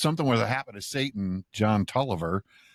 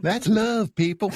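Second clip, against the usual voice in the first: higher, imitating the showman; Billy Bo Chief impression; 11 semitones higher than usual. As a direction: echo the showman's line, higher. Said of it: "I love that character".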